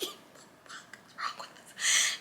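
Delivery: whispered disbelief; hushed, voiceless aside; shocked whisper. Retold or imitated. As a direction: whisper it in alarm under your breath. Her shocked aside in the car.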